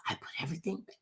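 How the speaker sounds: unvoiced guilty whisper. Real, not acted. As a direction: drop to a secretive whisper. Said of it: "'Cause I was whispering".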